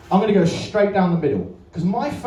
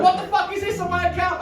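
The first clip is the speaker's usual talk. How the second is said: outraged mum; 12 semitones higher than usual. Retold or imitated. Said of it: "It's like".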